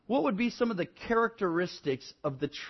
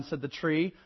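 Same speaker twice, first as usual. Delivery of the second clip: usual speaking voice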